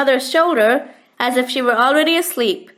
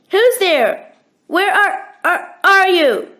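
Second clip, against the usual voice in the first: shouted; 5 semitones higher, slower than usual. A narrator's reading. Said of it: "a man's voice shouted".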